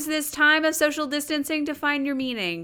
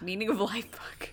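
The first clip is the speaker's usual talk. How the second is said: whispered echo of the title; 3 semitones lower, 8 dB quieter than usual. Real, not acted. Echoing the book title in a whisper.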